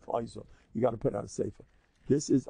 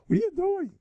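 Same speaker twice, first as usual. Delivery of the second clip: incredulous rebuke. Acted. Rebuking self-critical listener.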